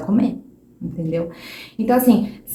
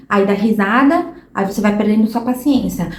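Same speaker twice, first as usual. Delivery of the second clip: ordinary talk, nothing marked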